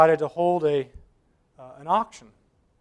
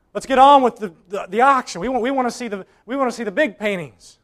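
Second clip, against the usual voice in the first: higher, louder, irritated call from the back; impatient heckle; 7 semitones higher, 9 dB louder than usual. Acted. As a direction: hurry the auction along, fed up. Impatient bidder in back row.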